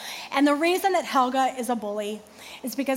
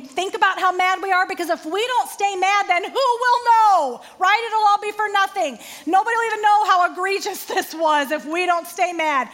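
mock indignation; 6 semitones higher, 4 dB louder than usual. Mocking our need to stay mad.

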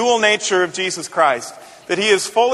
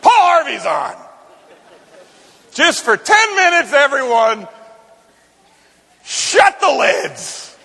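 high, loud excited dad; dad calling out; 7 semitones higher, 3 dB louder, slower than usual. Retold or imitated. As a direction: call it out over the kids, slowly on the end. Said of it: "my dad would say".

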